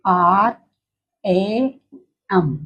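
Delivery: letter by letter: आर, ए, एम; spelling out letters. Shown as a demonstration. Teacher shows pupils how they read the word now, spelled out. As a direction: say R, A, M as separate letter names, slowly. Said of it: "इसको क्या पढ़ते हो".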